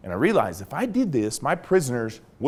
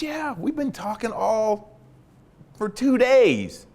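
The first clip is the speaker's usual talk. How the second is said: higher, slow, drawn-out protest; protesting prisoner; 8 semitones higher, slower than usual. Retold or imitated. Prisoner protesting to his interrogator, high and drawn out. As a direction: stretch it out high, insisting.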